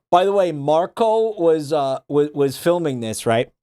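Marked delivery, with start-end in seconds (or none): none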